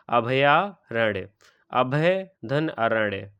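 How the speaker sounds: अभयारण्य split slowly into अभय धन अरण्य; teacher slowly taking the word apart; slow word-splitting explanation; slow breakdown. Shown as a demonstration.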